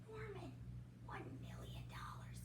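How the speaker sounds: breathless whispered aside; awed whisper. Real, not acted. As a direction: whisper it in amazement.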